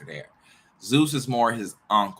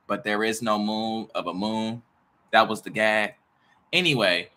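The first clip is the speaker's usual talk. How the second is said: ordinary talk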